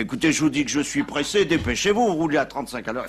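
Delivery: high, loud and pressing, rushing the driver; impatient pope. Acted. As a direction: snap at the driver to hurry up. Pope impatient with slow taxi.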